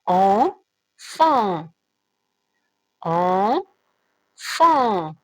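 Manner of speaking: "enfant" drawn out very slowly, both nasal vowels held; très lentement. Shown as a demonstration. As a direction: stretch every sound of "enfant" for children. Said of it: "la différence entre en". ("enfant" articulé très lentement, voyelles nasales tenues)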